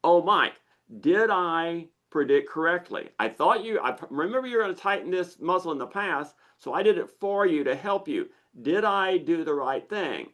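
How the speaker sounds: neurotic lizard brain. Acted. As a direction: play an anxious helper seeking approval. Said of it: "Your lizard brain is neurotic and insecure".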